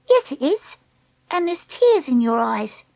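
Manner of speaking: put-on high, insistent girl; taunting. Treacle spotting Dakota's tears, taunting.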